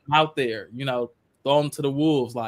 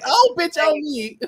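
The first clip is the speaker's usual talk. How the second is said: dramatic exclamation; 10 semitones higher, 5 dB louder than usual. Pretend.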